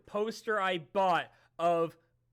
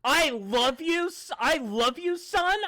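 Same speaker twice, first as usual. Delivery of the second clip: loud, slow, suspicious dad voice, higher; dad's accusing voice; 5 semitones higher, 6 dB louder, slower than usual. Retold or imitated. Son mimics his dad turning love into an accusation. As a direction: make a loving line sound like an accusation. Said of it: "my dad's voice".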